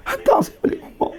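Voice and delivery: very high whispered whine of a crying wife; mock tearful wife. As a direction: squeak it out like a sulking wife begging. Mimicking wife crying to go home. (suara isteri merengek, tinggi dan berbisik)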